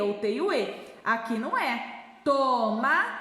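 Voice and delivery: ordinary talk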